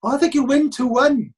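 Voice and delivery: high-pitched mimicry of a confident partner; Vicky. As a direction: pitch it up, as a mock reassuring companion. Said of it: "Vicky saying to me".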